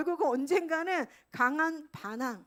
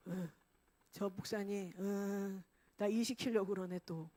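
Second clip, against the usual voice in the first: suspicious grumble; 5 semitones lower, 7 dB quieter than usual. Retold or imitated. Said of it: "이렇게 반응을 하는 거예요".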